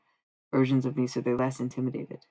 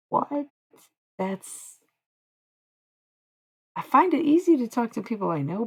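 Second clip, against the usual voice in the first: ordinary talk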